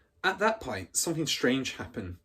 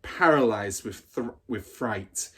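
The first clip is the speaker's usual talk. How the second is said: ordinary delivery, nothing marked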